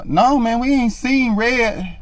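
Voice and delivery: high but quiet, slow answer; confused man backing down; baffled mumble. Retold or imitated. Homeless man answering her, slow, high and quiet.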